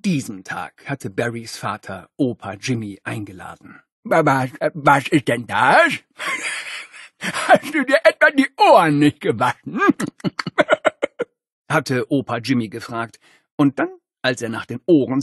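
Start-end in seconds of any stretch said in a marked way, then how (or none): 4.0-9.8 high playful grandpa voice; teasing old grandpa; Opa Jimmy. Acted. Grandpa teasing grandson, magic trick. 9.9-11.2 playful high grandpa chuckle. Acted. Grandpa laughing after trick.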